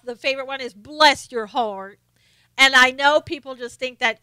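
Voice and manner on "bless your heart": slow, drawn-out Southern drawl; sampling a Southern catchphrase, slowly; Southern saying. Shown as a demonstration. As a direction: stretch it out in a Southern accent. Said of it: "my favorite thing in the South".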